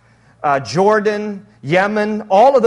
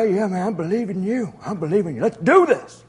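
put-on old Abram voice. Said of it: "Let me get the voice".

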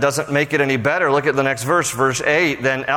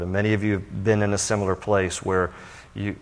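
ordinary talk, nothing marked

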